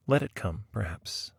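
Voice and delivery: ordinary delivery, nothing marked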